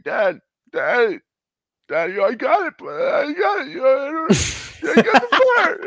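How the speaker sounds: very high, loud, sobbing; high crying shout of news; crying to dad. Retold or imitated. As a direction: blurt it out through tears. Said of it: "I'm like".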